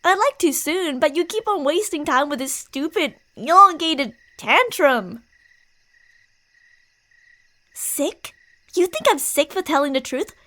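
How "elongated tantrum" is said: slow and louder on "elongated tantrum"; emphatic. Acted. Friend scolding the listener's tantrum, slow and emphatic. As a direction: lean hard on "elongated tantrum".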